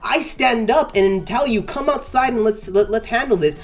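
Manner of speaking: furious rant at the instigator; angry rant. Retold or imitated. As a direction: lay into him, furious.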